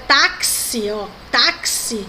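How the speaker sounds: táxi, met de x als ks; slow careful táxi. Shown as a demonstration. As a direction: spell it out: táxi, ks-klank duidelijk. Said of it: "o X com o som de C S".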